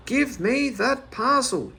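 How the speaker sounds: high, slow angry yell. Acted. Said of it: "yelled the man".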